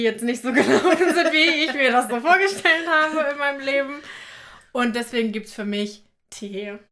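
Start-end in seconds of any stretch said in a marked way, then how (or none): 0.0-3.9 laughing. Real, not acted. Laughs about the failed exam.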